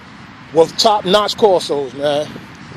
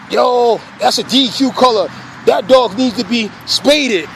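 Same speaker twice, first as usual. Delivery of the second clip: ordinary talk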